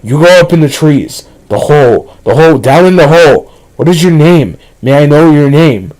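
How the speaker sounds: loud, high, insistent creature shouting; frenzied yammering. A narrator's reading. Thing outside the door yammering at Daniel, loud and frenzied. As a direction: yammer it out loudly, demanding an answer. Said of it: "the thing just kept yammering".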